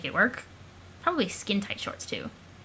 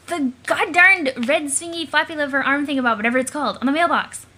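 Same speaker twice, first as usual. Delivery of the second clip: exasperated excitement; 4 semitones higher, 6 dB louder than usual. Acted. Exasperated character describing mailbox flag.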